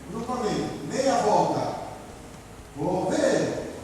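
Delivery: chamada alta e projetada, com "Vou" alongado; voz de comando. Shown as a demonstration. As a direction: comande o grupamento, soltando "ver" com firmeza. Instrutor dá comando de ordem unida. (comando de ordem unida, agudo e forte)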